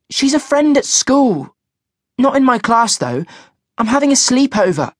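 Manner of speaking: put-on high teenage daughter voice; young girl voice. A narrator's reading.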